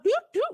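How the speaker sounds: very high tooting noise; mock sound effect. Streamer mocks the guest's age, very high toot. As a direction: toot like a little alarm.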